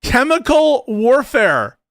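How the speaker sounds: slow, high, loud repeat in disbelief; real loud astonishment; incredulous exclamation. Real, not acted.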